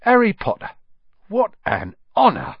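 put-on high, loud, thrilled old barman; a barman who can't contain his joy, high and loud; overjoyed barman. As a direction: burst out in delight. Barman overjoyed meeting Harry.

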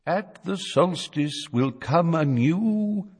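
high, slow eerie prophecy voice; ghostly old man. A narrator's reading. Old bearded figure prophesying, slow high eerie. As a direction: intone it like a ghost. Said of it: "the figure of an old bearded man".

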